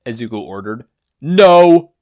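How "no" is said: higher, very loud flat refusal; yell. Acted. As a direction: shut it down hard. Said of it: "New Order yelled".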